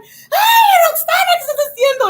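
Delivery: loud, high, fast mock scolding; mad mother. Mother arriving at the door, imitated angry yell. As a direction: holler in Spanish as an angry mom. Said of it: "she gets all mad".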